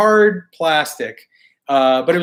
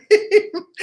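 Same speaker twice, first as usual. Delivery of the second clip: high laugh. Real, not acted. Laughing at a late comment.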